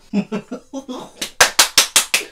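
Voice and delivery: unvoiced, drawn-out laugh; wheezy fit of giggles; helpless giggle. Real, not acted. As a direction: laugh it out without much voice. Laughs after refusing to look.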